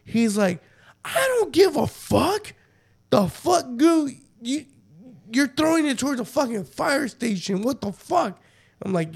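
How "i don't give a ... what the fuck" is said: high-pitched, indignant retold outburst; mad friend. Speaker acts Manny scolding Goo over the thrown bottle, mad and high-pitched. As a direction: act out an angry scolding, pitch way up. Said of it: "he got mad".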